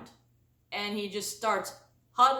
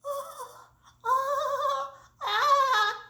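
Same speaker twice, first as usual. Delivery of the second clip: high, drawn-out mock wail; much slower than usual. The kid on the ground, high mock bawling.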